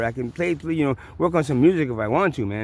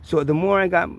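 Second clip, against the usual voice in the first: ordinary talk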